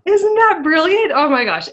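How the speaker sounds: thrilled outburst, high and loud; excited. Real, not acted. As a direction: gush over how brilliant it is. Moved by the book's message.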